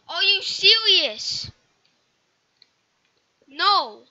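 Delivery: loud and slow, disbelieving; exasperated yell. Real, not acted. Gamer yelling at the game in disbelief.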